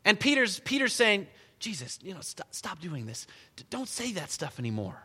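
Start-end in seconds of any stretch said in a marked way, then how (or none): 1.6-5.0 soft, hesitant private reproach; lowered-voice plea to a friend; hushed rebuke. Acted. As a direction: quietly urge him to stop, stumbling a little. Peter privately rebukes Jesus, acted.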